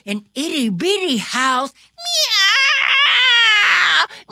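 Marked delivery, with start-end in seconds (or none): 1.9-4.3 cat crying meows. A narrator's reading. Cat cries at alarm.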